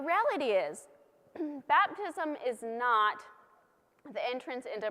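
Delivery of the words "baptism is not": ordinary delivery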